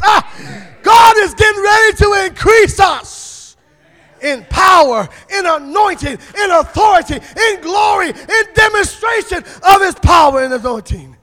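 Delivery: high, loud preaching shout; rally the whole room; preaching at full force. Acted. Preacher shouting a promise of increase.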